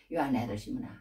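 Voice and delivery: low, soft and tender questioning; warm, gentle. Acted. Jesus gently asking fallen Simon for his love, warm. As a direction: ask it kindly, voice dropped right down. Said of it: "그렇게 따뜻하게 대해주시는 거예요".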